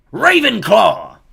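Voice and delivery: loud, high drawn-out shout; hat shouting. A narrator's reading. The hat shouts its verdict to the hall. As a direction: announce the house with a booming cry.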